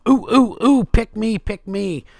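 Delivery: high eager ooh pick me; overeager. Pretend. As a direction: play the keen student begging to be picked. Mimics eager student raising hand.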